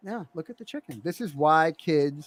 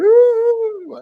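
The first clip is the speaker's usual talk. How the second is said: put-on guilty kid gasp; 9 dB louder than usual. Son reacts to broken rule.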